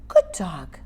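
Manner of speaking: drawn-out, soothing praise; gentle approving dog praise; praising tone. Shown as a demonstration. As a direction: reward the dog with a kindly change of voice. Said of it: "Did you hear the change in my voice?".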